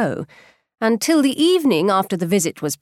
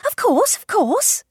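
much higher, slow eager cry; eager exclaiming; 12 semitones higher, slower than usual. A narrator's reading. Wife eagerly agreeing with her husband, high and slow. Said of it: "cried Missus Bennet".

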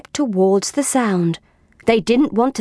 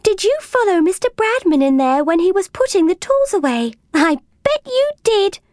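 tag; 9 semitones higher than usual. A narrator's reading.

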